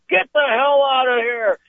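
mock-angry order to get out; slow, high-pitched retold yell; angry store owner. Store owner throws fighting boys out.

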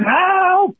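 imitating a frantic scream for help; retold scream for help. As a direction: yell it out like a panicked man at the river. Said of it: "so the guy starts screaming".

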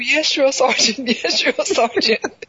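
high, slow recruit answer with laughs; recruit reply. Retold or imitated. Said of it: "they have to say".

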